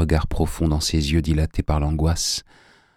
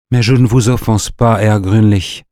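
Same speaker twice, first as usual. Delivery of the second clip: higher, louder protest; insistent, raised-voice denial; hasty protest; 4 semitones higher, 6 dB louder than usual. A narrator's reading. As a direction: protest emphatically to an offended suitor. Tony hastily protests to offended suitor.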